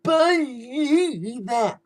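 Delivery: milk it in a high mock voice; hammed-up high catchphrase impression; high-pitched Bo Dallas impersonation. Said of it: "with a high-pitched voice like that".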